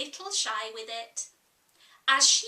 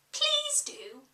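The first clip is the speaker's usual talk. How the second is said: polite higher whisper; very politely; 12 semitones higher than usual. Acted. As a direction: breathe it out with perfect manners. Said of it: "Alice said very politely".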